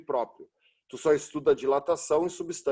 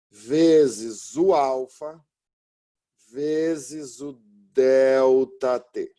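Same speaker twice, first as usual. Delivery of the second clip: slow, louder, word by word; slow dictation; 5 dB louder, much slower than usual. Shown as a demonstration. Teacher dictating the formula for students to write: slow and loud. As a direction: spell the formula out so students can copy it.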